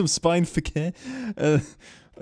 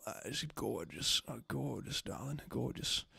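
fawning darling voice; 2 semitones lower, 16 dB quieter than usual. Pretend. Fawning over own creation.

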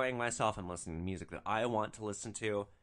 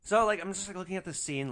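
ordinary talk, nothing marked

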